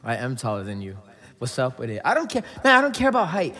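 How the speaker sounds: usual speaking voice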